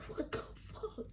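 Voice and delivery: soft, voiceless stunned reaction; hushed acted disbelief; whispered shock. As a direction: whisper it, taken aback. Startled by sudden confrontation.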